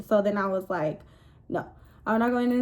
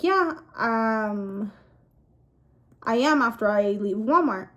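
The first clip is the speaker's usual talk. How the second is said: ordinary talk, nothing marked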